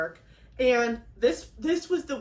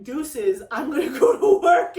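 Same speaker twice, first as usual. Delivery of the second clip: higher, louder laughing impression of him; mock his careless exit with a laugh; laughing mock husband; 3 semitones higher, 8 dB louder than usual. Wife mocking husband leaving for work, laughing. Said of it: "he's like".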